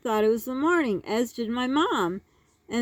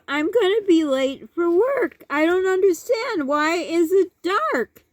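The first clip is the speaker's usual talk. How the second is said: flustered mom; 6 semitones higher, 4 dB louder than usual. Retold or imitated. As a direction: imitate mom's high, flustered protest. Mom frantic about work.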